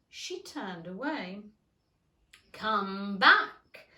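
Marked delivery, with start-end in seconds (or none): 2.5-3.9 loud call after someone. A narrator's reading. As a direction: call it after her loudly. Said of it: "the caterpillar called after her".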